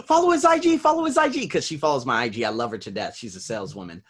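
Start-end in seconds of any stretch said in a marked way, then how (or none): 0.1-1.5 much higher and louder urging; excited classmate. Retold or imitated. Classmate excitedly urges the class, much higher and louder. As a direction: shout it like an eager fan. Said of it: "another girl in the class was like".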